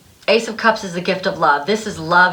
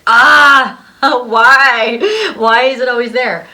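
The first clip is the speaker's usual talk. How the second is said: exasperated outcry; 5 semitones higher, 8 dB louder, much slower than usual. Retold or imitated. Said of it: "And you're like".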